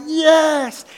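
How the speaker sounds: high, loud mock cheer; hammed-up eager yes. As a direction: pump the fist with it. Disciples cheering in retold scene.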